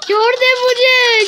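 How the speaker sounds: slow, high, loud begging; wailing plea. Real, not acted. Gamer pleading with the flower to release him, loud wail. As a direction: cry out let me go.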